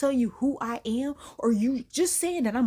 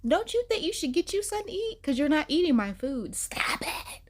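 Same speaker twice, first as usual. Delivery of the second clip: high sassy scolding; 6 semitones higher than usual. Retold or imitated. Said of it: "I would be like".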